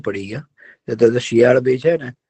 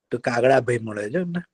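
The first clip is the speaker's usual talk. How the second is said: ordinary delivery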